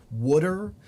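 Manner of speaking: "wooder" rhyming with "would her"; Baltimore pronunciation. Shown as a demonstration. Streamer showing Baltimore speech, "wooder" with oo and D. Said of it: "They say".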